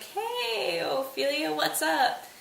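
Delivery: high, sing-song hey to Ophelia; chirpy queen greeting. Retold or imitated. Queen to mad Ophelia, high sing-song hello. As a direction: greet her in a bright, high, fake-friendly way.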